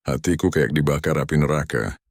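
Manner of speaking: plain reading voice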